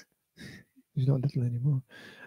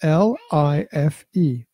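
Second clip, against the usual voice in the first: L I F E letter by letter, slowly; spelling out; 2 semitones higher, 4 dB louder, much slower than usual. Shown as a demonstration. Dad teaching how life is spelt, spelling slowly. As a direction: spell L I F E with each letter separate and deliberate. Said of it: "so it's that spell".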